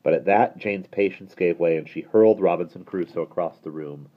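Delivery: plain reading voice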